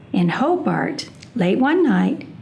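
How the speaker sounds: ordinary delivery, nothing marked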